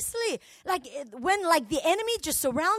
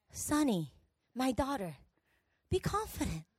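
soft, tender fatherly voice; God; 6 semitones lower, 8 dB quieter than usual. Acted. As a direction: reassure a daughter quietly, with pauses. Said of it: "You know what God said?".

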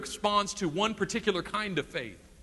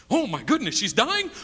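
mock alarm; 5 semitones higher than usual. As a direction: play up the panic. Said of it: "catastrophize with him".

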